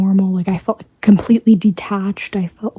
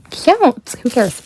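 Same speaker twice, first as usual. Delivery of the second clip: ordinary delivery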